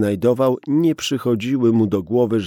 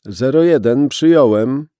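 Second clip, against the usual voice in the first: loud, higher radio acknowledgement; radio dispatcher; 4 semitones higher, 9 dB louder than usual. Acted. Dispatcher replying to the policeman over the radio, loud and brisk. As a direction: answer crisply like a dispatcher.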